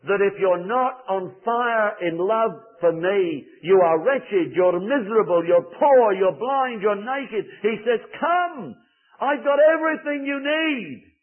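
high, loud impassioned preaching; fervent preaching. Acted. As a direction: plead with the whole congregation. Preaching at full force on Christ's call.

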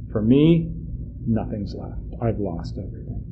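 put-on an old father letting go of everything; understated grieving farewell; sorrowful blessing. Israel's blessing, giving up Benjamin.

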